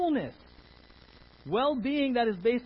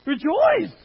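shouted command; 8 semitones higher, 11 dB louder than usual. Real, not acted. Preacher to congregation, calling the command out loud. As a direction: belt out Rejoice with full force.

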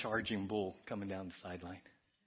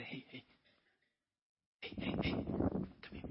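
whispered hey hey, come here; hushed call; slower than usual. Acted. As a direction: whisper to pull a friend aside. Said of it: "come alongside and say".

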